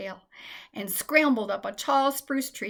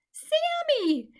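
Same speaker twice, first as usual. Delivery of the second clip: very high loud call; lost mouse calling for the squirrel, high loud; crying out; 4 dB louder, much slower than usual. A narrator's reading. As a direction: shout into the trees for her friend. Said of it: "Michaela cried out".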